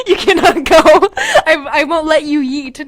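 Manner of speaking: breaking into laughter through the line; high, loud laughing reread. Acted. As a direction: giggle through the plea.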